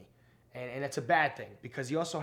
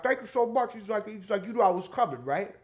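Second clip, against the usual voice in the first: much higher, louder, different voice; Tracy Morgan impression; 9 semitones higher, 8 dB louder than usual. Comic imitating the star thanking the host, high and loud. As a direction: do a bad celebrity voice on purpose. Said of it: "a horrible Tracy Morgan impression".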